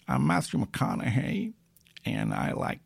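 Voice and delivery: Matthew McConaughey. Shown as a demonstration. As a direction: ease into a soft, lazy film-star purr. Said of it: "an impression of Matthew McConaughey".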